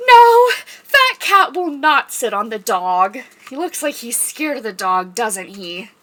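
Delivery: ordinary delivery, nothing marked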